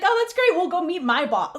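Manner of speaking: high, bright, oblivious professor, laughing through it; mock cheery professor. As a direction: play the professor as cheerily clueless. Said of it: "the professor's like".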